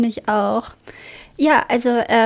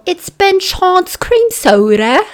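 put-on posh slow British accent, higher; 5 semitones higher, 5 dB louder, slower than usual. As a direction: play the proper Brit offering a gift. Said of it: "kommt bei mir so ein britischer Akzent durch".

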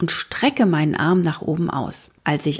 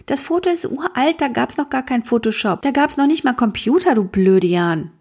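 high, annoyed little sister setting her brother straight; little sister correcting her brother in a high voice; indignant little girl; 7 semitones higher than usual. A narrator's reading. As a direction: scold your know-it-all brother.

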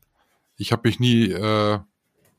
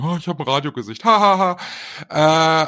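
mock radio joker; 4 semitones higher than usual. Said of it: "der Running Gag bei Radioleuten".

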